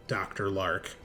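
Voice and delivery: ordinary delivery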